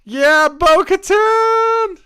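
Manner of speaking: a high, loud, slow drawn-out cheer; excited cheer. Real, not acted. A fan cheering Bo-Katan on screen, loud and excited. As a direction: stretch out her name like a fan. Favorite character appears on screen.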